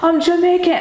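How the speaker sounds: high, excited announcement in a shaky attempt at Jamaican; attempted Jamaican accent. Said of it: "do the accent".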